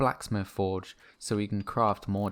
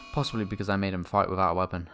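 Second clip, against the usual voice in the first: ordinary talk, nothing marked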